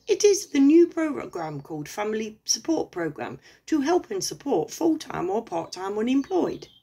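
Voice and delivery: a hammed-up friendly con man; mock scammer. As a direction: put on the eager scammer's sales pitch. Voicing scammer's reply, raised pitch.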